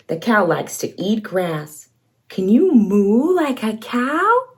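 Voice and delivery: ordinary delivery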